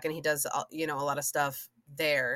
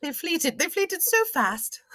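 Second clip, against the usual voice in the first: playful mock echo; 11 semitones higher, 3 dB louder, slower than usual. Echoing the made-up verb.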